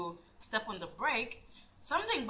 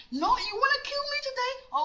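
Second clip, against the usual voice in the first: very high, hysterical comeback; retold manic outburst, voice shooting up; crazed defiance. Retold furious reply while driving.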